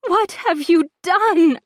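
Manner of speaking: high, loud, slow wail; anguished wail. Acted. Laura wailing at Bronwyn over her child. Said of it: "I wail".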